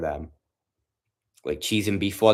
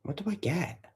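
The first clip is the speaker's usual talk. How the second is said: much higher, soft, put-on pondering voice; silly voice; 9 semitones higher, 8 dB quieter than usual. Streamer mulling his Subway order, high soft silly voice. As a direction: ask yourself in a small high silly voice.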